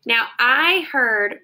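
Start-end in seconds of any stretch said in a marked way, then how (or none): none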